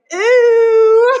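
high, loud grossed-out shriek; recalling the bikini tan lines, a high, loud disgusted Ew. Retold or imitated. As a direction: squeal Ew as if recoiling from something gross. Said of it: "and I was like".

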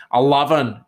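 Scottish-accented "eleven"; attempted Scottish accent. Shown as a demonstration. Vlogger showing the word he can nail, attempted Scottish accent. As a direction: proudly demonstrate "eleven" in Scottish. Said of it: "the Scottish word that I can nail".

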